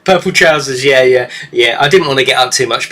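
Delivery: normal talking voice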